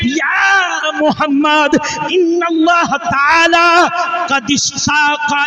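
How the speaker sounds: ringing heavenly announcement; angel's proclamation. Acted. Voicing angel Jibril's message.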